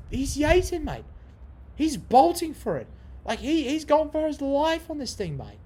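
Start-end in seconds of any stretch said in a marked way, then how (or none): none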